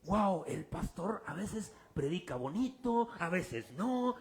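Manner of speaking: soft, slow, lazily impressed member; mock churchgoer. Lightly voiced churchgoer judging the pastor, soft and slow. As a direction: drawl it like a lukewarm churchgoer. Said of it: "pero dice".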